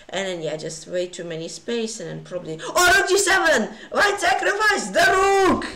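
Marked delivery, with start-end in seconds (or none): 2.6-5.7 loud, high thrilled exclamation; excited outburst. Real, not acted. Streamer spotting a rook sacrifice live, thrilled. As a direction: shout it out, amazed at the move.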